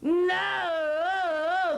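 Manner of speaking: high crying "No"; crying twin. A narrator's reading. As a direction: wail it up high. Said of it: "the twin said".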